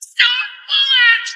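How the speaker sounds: battle cry. Acted. Casting spell.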